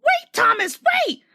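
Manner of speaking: very high, loud squeaky call; trucks calling. A narrator's reading. Silly trucks begging Thomas to wait, squealing. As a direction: squeal after the engine.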